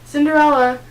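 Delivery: loud, high call up the stairs; shouting a name. Acted. Stepmother calls Cinderella down, shouting her name. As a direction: yell for her from downstairs. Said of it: "her stepmother shouted".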